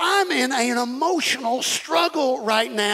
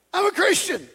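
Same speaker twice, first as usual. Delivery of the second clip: mock proud claim; 8 semitones higher than usual. Mock proud self-talk.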